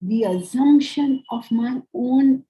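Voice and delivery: ordinary talk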